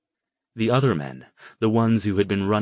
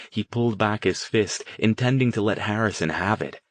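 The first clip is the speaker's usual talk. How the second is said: ordinary delivery, nothing marked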